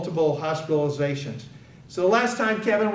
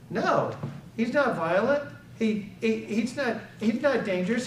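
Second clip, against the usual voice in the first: higher, halting retold denial; mildly flustered, pitched up; hesitant protest; 5 semitones higher than usual. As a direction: stumble over a defensive answer. Parent answering dispatcher, hesitant.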